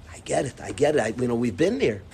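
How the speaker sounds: low, soft understanding; subdued been-there sympathy; validating parent. Shown as a demonstration. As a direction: commiserate quietly, pitch dropped. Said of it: "And you validate".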